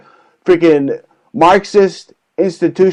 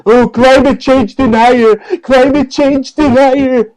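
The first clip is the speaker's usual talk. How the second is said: higher, whiny mocking protester chant; mock liberal scream; 8 semitones higher than usual. Mocking the liberals he rants about, whiny high chant. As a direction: parody a shrieking liberal, hammed up. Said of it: "liberal punani's are gonna scream into their loudspeakers".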